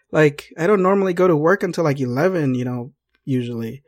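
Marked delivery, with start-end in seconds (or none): none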